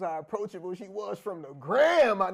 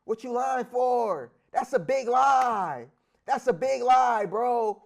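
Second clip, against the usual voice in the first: loud drawn call-out; 4 semitones higher, 9 dB louder, slower than usual. Real, not acted. Calling out liar.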